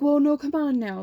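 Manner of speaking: a hint of an official cajoling a student; coaxing vice principal. Vice principal to student stepping back from trip, coaxing.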